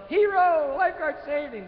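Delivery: very high, loud imagined crowd cheer; mock cheering yell. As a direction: hail the hero from the beach. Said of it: "instead of yelling".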